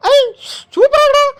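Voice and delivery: ordinary delivery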